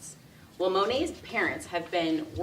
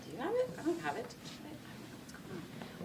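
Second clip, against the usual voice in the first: higher, fading to a panicked whisper; flustered groomsmen; 10 semitones higher, 9 dB quieter than usual. Retold or imitated. Groomsmen whispering to each other about the rings, flustered. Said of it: "they all start just kinda like".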